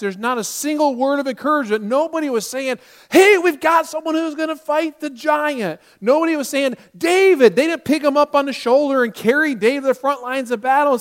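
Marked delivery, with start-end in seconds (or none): 3.1-5.8 loud, high-pitched excited announcement; imagined cheering crowd. Retold or imitated. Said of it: "cheering him on". 6.9-7.6 loud, high call of the name; imagined cheering. Retold or imitated. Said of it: "cheering him on".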